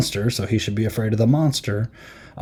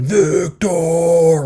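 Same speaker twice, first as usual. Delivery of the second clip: loud, higher anguished monster cry; scary monster yell; 6 semitones higher, 5 dB louder than usual. Retold or imitated. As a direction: bellow the name like a hunted monster. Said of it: "Like, really scary".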